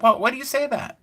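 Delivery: high-pitched mock indignation; retold angry demand. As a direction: push the question way up high. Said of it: "I got angry one time".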